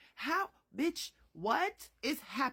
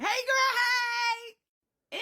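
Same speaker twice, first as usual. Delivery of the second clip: excited greeting; 11 semitones higher, 7 dB louder, much slower than usual. Real, not acted. Host greeting fans who saw her, loud squeal.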